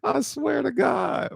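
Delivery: real high, squeaky swearing through laughter; helpless falsetto after a laughing fit; cracking up. Real, not acted. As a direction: wheeze it out, voice shot way up. Helpless after laughing at own story.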